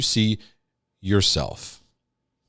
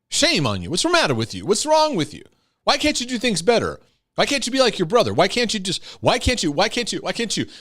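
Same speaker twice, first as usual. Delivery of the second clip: shaming parent; 8 semitones higher, faster than usual. Retold or imitated. Shaming parent voiced in sermon.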